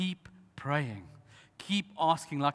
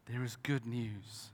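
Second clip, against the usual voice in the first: softer echo; 4 semitones lower, 7 dB quieter, slower than usual. Shown as a demonstration. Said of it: "But there is good news".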